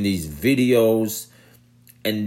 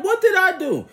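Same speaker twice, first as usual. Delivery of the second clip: high whiny what did I do; mock high indignant whine; whiny mock protest; 4 dB louder, faster than usual. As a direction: whine it up high like a caught rioter. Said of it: "he was like".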